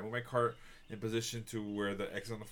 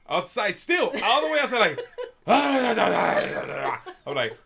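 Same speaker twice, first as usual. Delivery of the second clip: laughing; 11 semitones higher, 11 dB louder, much slower than usual. Real, not acted. Laughing while telling story.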